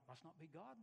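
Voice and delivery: student leaving the office heartbroken; dejected mutter. Retold or imitated. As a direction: give up quietly, crushed. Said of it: "walked out of there going".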